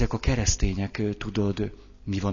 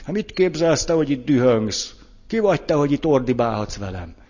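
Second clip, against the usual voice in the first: higher, indignant mock scolding; indignant retort; 4 semitones higher, 3 dB louder than usual. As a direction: act out taking offence at being yelled at. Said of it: "akkor én rögtön kikérem magamnak". (felháborodott visszavágás)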